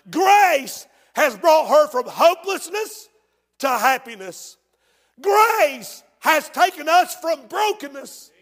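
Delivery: slow, high and loud proclamation of grace; full-force preaching. Real, not acted. Preacher exalts grace to the congregation, full-force preaching. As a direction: ring out the first word, building each phrase.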